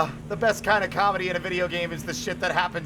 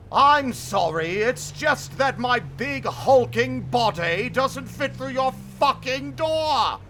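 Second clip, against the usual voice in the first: slow, higher monster whine; hammed-up monster lament; monster apology; 6 semitones higher, 3 dB louder, slower than usual. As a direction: apologise like a clumsy giant.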